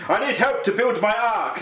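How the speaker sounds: high, slow proclamation; Noah. Acted. Comic Noah asking the people for help, hammed-up appeal. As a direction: plead grandly, stretching out the words. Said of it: "if Noah had to bribe them originally".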